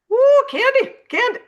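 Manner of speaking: high loud woo; cheering a viewer, high and loud; excited cheer. Real, not acted. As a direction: whoop at her name.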